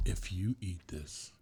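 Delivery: a hint of a stern warning; lightly voiced warning wife. Cohost voicing the wife warning the host, mild mock warning. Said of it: "She's like".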